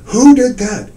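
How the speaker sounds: sudden loud, high demand. Retold or imitated. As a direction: blurt it out loud. Dealer demanding who painted it.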